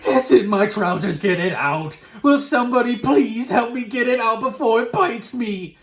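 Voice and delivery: high, loud pleading yell; still shouting. A narrator's reading. Cook begs staff to get the mouse out, shouting. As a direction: shout a frantic plea for rescue. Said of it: "I heard the stupid cook still shouting".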